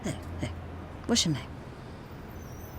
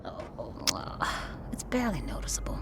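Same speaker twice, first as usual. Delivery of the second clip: soft dismissive whisper; 7 semitones lower, 8 dB quieter than usual. Acted. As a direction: brush it off quietly. Downplaying a wound.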